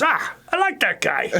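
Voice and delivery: high-pitched, hammed-up impersonation; bad Al Schmidt accent. Guest quoting the losing nominee on the winner, bad impression. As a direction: imitate an engineer friend badly, voice pushed up high. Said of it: "that's the worst Al Schmidt accent ever".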